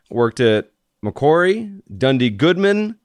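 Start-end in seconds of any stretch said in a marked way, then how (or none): none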